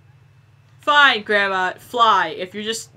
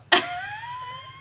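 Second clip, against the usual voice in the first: a sudden squealing laugh, much higher; high shrieking laugh; 5 dB quieter than usual. Real, not acted. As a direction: burst into a shrill cackle. Laughing at friend's fall.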